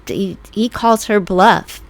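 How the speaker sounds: usual speaking voice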